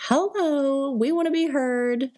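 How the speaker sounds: high, bright-eyed greeting voice; chirpy hello. Retold or imitated. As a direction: pipe up brightly to be noticed. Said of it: "popped in and were like".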